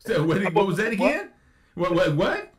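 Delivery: mock bafflement. Cyrus reacting to the president, mock incredulous questioning. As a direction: feign that you cannot believe what you heard. Acting Cyrus caught off guard.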